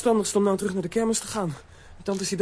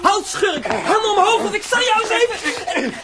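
high, loud commanding yell; shout; 12 semitones higher, 6 dB louder than usual. Acted. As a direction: order the crook to freeze. Holding up the crook.

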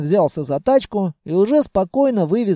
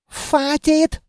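put-on high outburst in a Germanic accent; exasperated, western accent; 9 semitones higher than usual. A narrator's reading. Officer can stand it no longer, exasperated accent. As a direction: lose patience in an accented officer's voice. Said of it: "не выдержал офицер".

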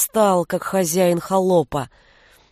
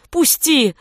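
desperate cry; 6 semitones higher than usual. A narrator's reading. Beating on door, pleading.

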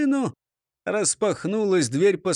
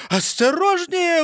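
high, drawn-out shout of warning; stretched high yell; cried-out warning; 13 semitones higher, 4 dB louder, slower than usual. A narrator's reading. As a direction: cry out in alarm, pitch way up. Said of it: "выкрикнул Ниса Чих".

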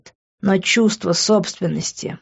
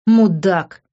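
through the teeth. A narrator's reading. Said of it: "процедил Лес".

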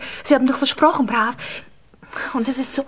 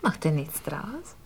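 langsam, sehr leise; leise gefragt; 8 semitones lower, 9 dB quieter, slower than usual. Acted. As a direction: fragt kaum hörbar nach. Geliebte fragt leise nach. (kaum hörbare Frage)

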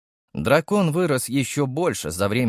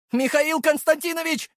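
shouting. A narrator's reading. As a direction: call out across the square. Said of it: "выкрикнул он".

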